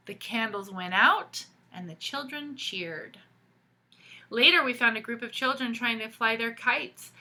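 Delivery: put-on lively read-aloud for kids; storybook reading. A narrator's reading. Teacher reading a picture book to children, animated storytelling. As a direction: tell the story to the class with expression.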